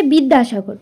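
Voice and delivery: ordinary talk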